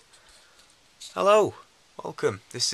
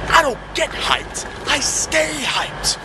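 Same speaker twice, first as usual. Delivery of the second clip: quoting a hype man; 12 semitones higher, 4 dB louder, slower than usual. Retold or imitated. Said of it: "I'm just taking this off this guy".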